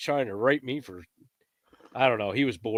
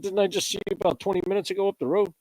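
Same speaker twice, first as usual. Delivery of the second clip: higher and quicker, mock disbelief; incredulous retold question; 9 semitones higher, faster than usual. Angler asks the warden who keeps following him, imitating disbelief. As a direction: rattle it off, acting amazed.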